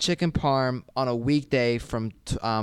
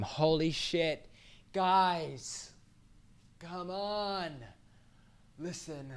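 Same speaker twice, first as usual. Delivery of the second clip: mock exasperation; 8 semitones higher, 8 dB quieter, much slower than usual. Real, not acted. Begging for Instagram followers.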